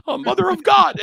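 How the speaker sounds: high exasperated groan; a hammed-up exasperated cry; mock despair. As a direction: throw your hands up. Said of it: "And I'm like".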